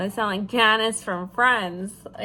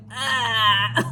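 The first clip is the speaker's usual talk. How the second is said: imitated disgusted wail; drawn-out "ah" of someone feeling gross; grossed-out groan; 3 dB louder than usual. Coming in the door drenched from rain, imitated grossed-out groan. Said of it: "like, I feel gross".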